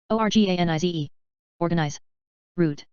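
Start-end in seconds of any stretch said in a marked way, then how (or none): 0.1-1.1 quick letter run; brisk recap spelling; fast spelling. Shown as a demonstration. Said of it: "spelling drill, slow then fast".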